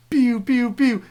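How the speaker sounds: high, loud "pew" zaps; laser shot noises. Retold or imitated. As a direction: imitate arcade lasers, high and punchy. Imitating the game's laser shots.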